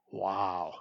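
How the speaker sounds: low, very soft wow; low, soft, awed; hushed awe. Real, not acted. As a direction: breathe it out as if struck by profundity. Mock awe at Jonah's words.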